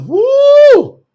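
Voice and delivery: very high, loud "woo"; giddy whoop. Acted. Acting out Sarah's giddy reaction.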